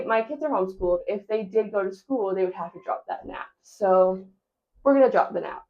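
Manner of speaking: usual speaking voice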